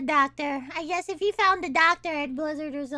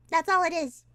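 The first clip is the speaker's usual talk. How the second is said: ordinary delivery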